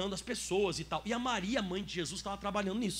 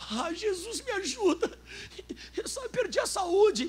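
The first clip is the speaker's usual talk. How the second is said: high, pitiful whine with a fake sob; mock whiny plea; 11 semitones higher, 5 dB louder, slower than usual. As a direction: whine it like a spoiled believer begging. Mock whiny prayer to Jesus. (súplica chorosa e fingida, bem aguda)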